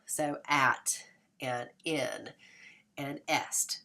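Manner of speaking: slow, separated rhyme units at, in, est; rhyme demo. Shown as a demonstration. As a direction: lay out at, in and est slowly. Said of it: "that's always the rhyme".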